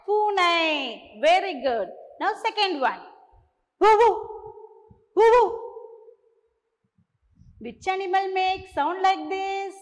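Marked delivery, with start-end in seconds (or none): 3.8-5.5 loud woofing dog; playful dog woof; dog bark. Shown as a demonstration. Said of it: "I will make a sound of an animal".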